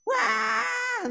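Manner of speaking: high mock baby wail; hammed-up infant cry; imitated baby wail. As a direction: wail like a baby denied its bottle. Father retells baby crying.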